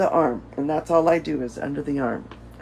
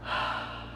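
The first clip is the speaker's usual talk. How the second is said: sigh; 9 dB quieter than usual. Shown as a demonstration. Breathing exercise, releasing breath.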